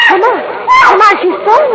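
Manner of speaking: high, loud urgent calling; panicked as she sees her fall; alarmed cry. Acted. Margot alarmed at opera.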